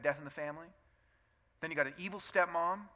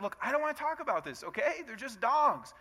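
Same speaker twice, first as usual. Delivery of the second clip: irritated husband; 8 semitones higher than usual. Retold or imitated. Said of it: "she keeps talking about it".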